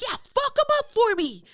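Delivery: very high, gleeful go-ahead; egging him on. Retold or imitated. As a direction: cheer the stranger on. Egging on stranger.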